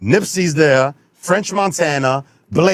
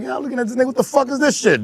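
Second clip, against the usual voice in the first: mock disbelief; 10 semitones higher, faster than usual. His own reaction to the chain.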